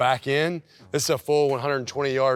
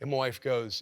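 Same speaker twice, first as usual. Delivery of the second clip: normal talking voice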